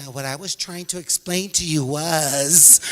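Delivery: slow, overly patient teacher voice; condescending teacher. Retold or imitated. Condescending teacher slowly explaining to Maria. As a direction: talk down slowly like to a child. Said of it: "so condescending".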